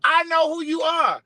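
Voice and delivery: high-pitched excited fan shout. Retold or imitated. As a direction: squeal it like a starstruck fan. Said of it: "He was like".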